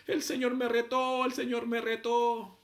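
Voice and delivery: high, drawn-out lament; stretched-out retold wailing; mock wail. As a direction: imitate a tearful friend confessing slowly. Tearful friend retold after the service.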